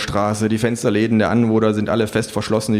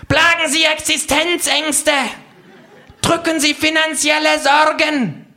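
extremely high, piercing shriek; shrill shout climbing ever higher; tiny screaming pollster; 4 dB louder than usual. A narrator's reading. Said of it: "Ein kleiner Soziologe ... schreit".

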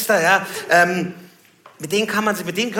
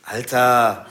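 low, slow tough-guy reply; lightly voiced Mehmet; 5 semitones lower, 3 dB louder, slower than usual. Bouncer scolding the teller about his mother, lightly low. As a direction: scold him like an offended bouncer. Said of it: "meint der Mehmet".

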